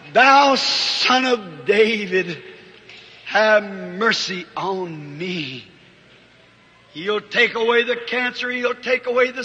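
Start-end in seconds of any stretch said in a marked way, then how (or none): 0.1-5.7 heartfelt cry. Acted. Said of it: "cry out from your heart".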